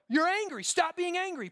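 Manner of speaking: high-pitched scolding, a touch of contempt; mock scolding. A moralist scolding Jonah, mock high scold. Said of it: "Point it out, condemn it".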